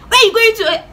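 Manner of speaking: high, sharp mock demand; parent calling after. Parent questioning fleeing child, high-pitched mock demand. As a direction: call out an urgent imitated challenge.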